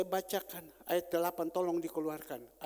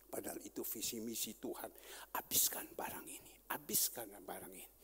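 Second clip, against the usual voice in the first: hushed recollection; 9 semitones lower, 8 dB quieter than usual. Real, not acted. Pastor recalling anger, hushed.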